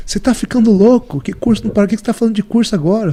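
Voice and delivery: high, loud disbelief, imitating her; mock the secretary's shocked exasperation; incredulous outburst. Secretary hears him cancel a course, loud high disbelief.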